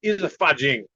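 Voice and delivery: lightly imitated Chinese-accented master. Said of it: "I like your Chinese accent".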